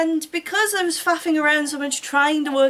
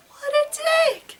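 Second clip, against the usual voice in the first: much higher, slow, drawn-out scandalized wail; outraged wail; slower than usual. Acted. Heroine wailing at the rude boyfriend, drawn-out scandalized wail. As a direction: stretch it out, scandalized.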